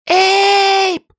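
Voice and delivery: very high shrill scream of his name; frightened scream. A narrator's reading. Li screams for Abe in terror.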